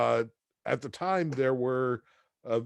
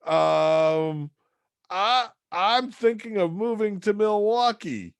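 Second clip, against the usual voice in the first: higher, hesitant caller caught off guard; flustered young caller, pitched up; nervous caller; 7 semitones higher, 4 dB louder, slower than usual. Retold or imitated. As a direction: stammer like you never expected an answer. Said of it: "And I'm like".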